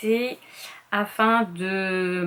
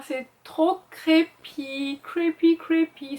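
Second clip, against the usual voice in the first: high, slow creepy delight; mock creepy; 7 semitones higher, slower than usual. Real, not acted. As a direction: savor the word creepy like a spooky tale. Admire une couverture effrayante. (voix ravie et mystérieuse sur "creepy")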